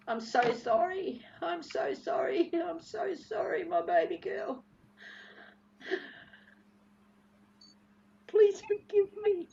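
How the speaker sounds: spent, tearful begging for forgiveness; crying. Real, not acted. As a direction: repeat the apology as it crumbles into tears. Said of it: "I'm gonna cry".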